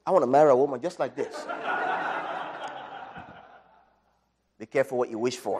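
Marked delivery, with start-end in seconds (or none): 0.1-1.3 laughing. Real, not acted. Joking about marrying such a woman. 1.4-3.3 unvoiced breathy laugh. Real, not acted. Laugh after his own joke.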